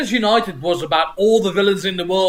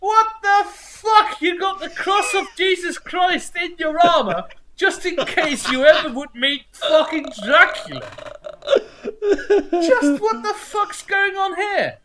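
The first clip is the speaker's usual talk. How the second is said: baffled outburst; 11 semitones higher than usual. Retold or imitated. Ranting to his co-host about Doctor Doom, incredulous high shout. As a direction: yell it in stunned disbelief, half laughing. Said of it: "I'm like".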